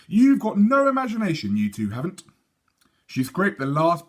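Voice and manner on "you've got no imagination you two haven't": higher, louder scornful retort; mildly scornful little sister. Acted. Sophie scorning her brothers, mildly scornful. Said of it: "looking scornfully at her brothers".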